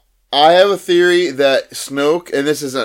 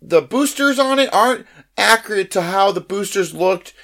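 higher, eager nerd asking a fussy question; nerdy fan voice; 5 semitones higher than usual. Retold or imitated. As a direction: play the pedantic Star Wars fan, pitched up. Nerdy fan asking Disney president.

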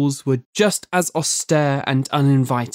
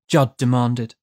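plain reading voice

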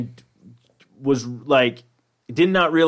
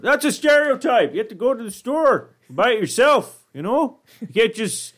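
mock Canadian; 9 semitones higher than usual. Acting a Canadian figure.

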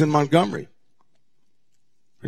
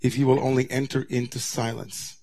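soft, hushed, gentle voice of God; softer tone; 5 semitones lower, 9 dB quieter than usual. Retold or imitated. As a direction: ease down into a hushed, tender near-whisper. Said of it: "But God, in a softer tone".

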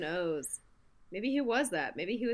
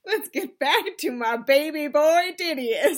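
excited; 6 semitones higher, 8 dB louder than usual. Real, not acted. Doting loud cheer.

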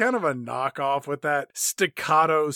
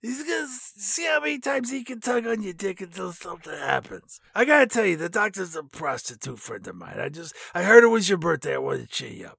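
mock chief; 2 semitones higher than usual. Joking chief's crude reply.

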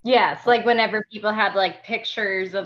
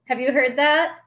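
ordinary talk

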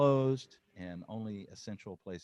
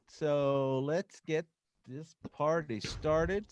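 slow announcer; 3 semitones higher, much slower than usual. Acted. Host kicks off show.

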